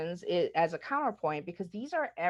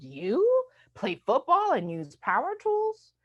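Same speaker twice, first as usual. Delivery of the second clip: high, slow, incredulous question; mock disbelief; 8 semitones higher, 5 dB louder, slower than usual. Stranger told women play football, incredulous. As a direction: draw it out in loud astonishment.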